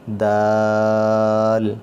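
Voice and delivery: دال with a hard d and the vowel held very long; hard lengthened dal. Shown as a demonstration. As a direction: stretch دال, hit the d hard. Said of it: "آواز اس کی سخت ہوگی اور اس کو مد کر کے". (سخت اور لمبی دال)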